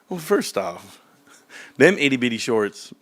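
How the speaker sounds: ordinary delivery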